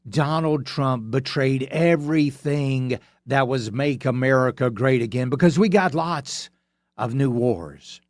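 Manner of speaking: slow, deliberate spelling-out; slowly. Shown as a demonstration. Slowing down to lecture Republican voters, slow. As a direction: lay it out slowly for the slow listener. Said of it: "I'm gonna say this slowly".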